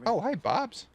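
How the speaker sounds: high, cheerful voiced-up hello; goofy high greeting. Gamer greeting his favourite character in a high voice. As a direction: greet him in a squeaky friendly voice.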